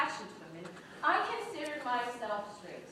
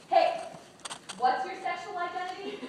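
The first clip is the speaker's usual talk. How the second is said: lightly acted prying question, higher pitch; nosy questioner; 6 semitones higher, 4 dB louder than usual. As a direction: pipe up like a curious stranger, mildly mock. Voices people asking about identity.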